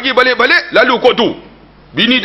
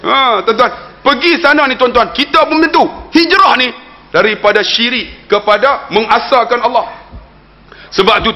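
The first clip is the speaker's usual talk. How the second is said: high-pitched, urgent exhortation; impassioned sermon delivery, pitched well up; preach; 9 semitones higher than usual. Real, not acted. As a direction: drive the lesson home to the congregation.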